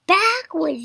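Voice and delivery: high, loud command; yelled. A narrator's reading. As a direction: yell out the next move. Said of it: "yelled Piggy".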